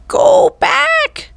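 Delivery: very high squawking parrot. A narrator's reading. Parrot Polly squawks a warning from above. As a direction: screech it like a parrot. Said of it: "said Polly".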